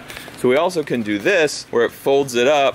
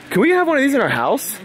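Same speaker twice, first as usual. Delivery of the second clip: excited; 10 semitones higher than usual. Real, not acted. Excited about home folder.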